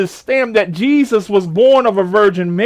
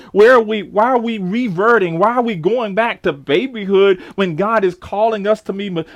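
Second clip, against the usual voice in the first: ordinary talk, nothing marked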